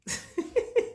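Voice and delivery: soft high giggle; light, quiet little titter. Real, not acted. Asking viewers about the head wrap, giggle.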